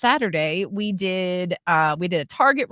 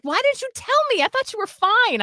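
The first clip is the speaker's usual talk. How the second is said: high-pitched mock indignant plea; imitating fiance's protest; 14 semitones higher than usual. Fiance asking why he wasn't told, mock protest. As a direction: protest in a squeaky put-on voice.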